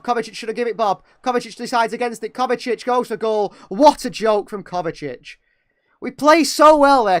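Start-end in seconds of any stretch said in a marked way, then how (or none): 3.7-7.2 frustrated outburst. Real, not acted. Fan commentator frustrated at wasted move.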